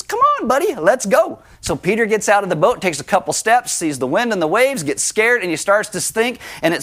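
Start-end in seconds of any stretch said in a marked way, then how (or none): 0.0-1.4 high-pitched, chummy beckoning; playful encouragement. Acted. Jesus calling Peter out onto the water, playfully encouraging. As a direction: coax a pal along in a raised voice.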